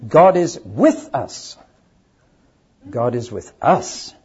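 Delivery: God is, then a pause, then with us; stress on WITH US. Shown as a demonstration. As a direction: pause before with us. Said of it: "pretty evenly divided".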